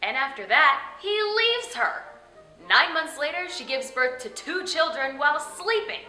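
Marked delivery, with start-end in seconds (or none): none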